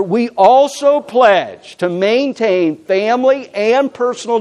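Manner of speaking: deliberate, projected group reading; reading aloud together. Real, not acted. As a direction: lead a congregation reading a pledge aloud, measured and emphatic. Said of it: "Let's read this together out loud".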